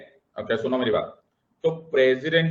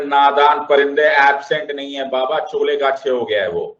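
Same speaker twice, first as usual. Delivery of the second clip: louder, exasperated retort; mock scolding; 8 dB louder than usual. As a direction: scold a naive friend loudly. Teacher scolds friend in retold quote.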